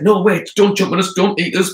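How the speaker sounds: high, loud, urgent begging; acted alarm, pitched well up; panicked pleading. A narrator's reading. Anansi begs the leopard not to eat them, high and panicked.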